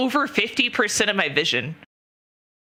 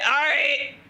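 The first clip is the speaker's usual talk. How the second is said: fired-up resignation; 7 semitones higher than usual. Retold or imitated. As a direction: psych yourself up, half laughing. Resigned, heading to hospital.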